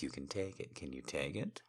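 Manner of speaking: quiet question rising at the end; hushed, teasing question; soft challenge. Acted. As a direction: ask it softly, lifting on "it?". Teasing challenge, asked softly first.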